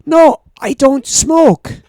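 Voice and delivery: slow, loud, high no; firm refusal. Retold or imitated. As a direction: spell out the refusal slowly. Retold refusal.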